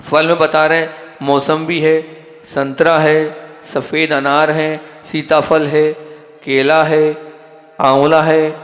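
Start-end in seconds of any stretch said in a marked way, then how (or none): 1.2-8.5 slow, deliberate listing of fruits; speaking slowly. Shown as a demonstration. Preacher listing fruits to devotees, deliberately slow. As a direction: spell each fruit out slowly for the audience. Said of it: "अब तो धीरे धीरे बोल रहा हूँ ना".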